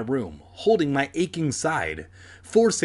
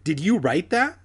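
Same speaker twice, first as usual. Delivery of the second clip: mildly alarmed, higher question; raised pitch, understated tremble; trembling demand; 5 semitones higher, 4 dB louder than usual. A narrator's reading. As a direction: demand it with a touch of fear. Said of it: "she demanded in a trembling voice".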